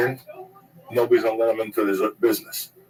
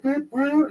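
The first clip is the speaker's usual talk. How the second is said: high squeaky beep beep like a cop horn; mock siren beep. Mimicking a cop car's beep at the homeless guy, high and squeaky. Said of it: "the cop's like".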